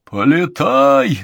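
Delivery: heckler shout. Acted. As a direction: bawl it from the cheap seats. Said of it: "загорланил горожанин".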